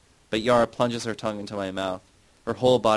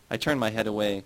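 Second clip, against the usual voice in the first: ordinary delivery, nothing marked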